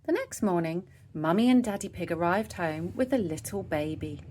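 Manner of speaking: usual speaking voice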